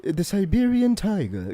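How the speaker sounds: slow, hushed-wonder British wildlife narrator parody; David Attenborough. Acted. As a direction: introduce a rare animal in a British documentary voice.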